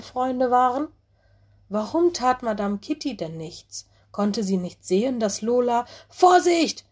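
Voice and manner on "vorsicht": high, loud alarmed cry of warning; loud warning shout. A narrator's reading. Little wolf yelling a warning into the laughter. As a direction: shout it out to stop the danger. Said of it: "rief Mino laut".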